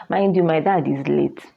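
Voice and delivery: usual speaking voice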